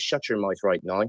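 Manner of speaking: drawn-out Northern Irish phrase, quietly done; Northern Irish accent. Shown as a demonstration. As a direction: demonstrate a Northern Irish catchphrase. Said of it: "the phrase I always say in my head".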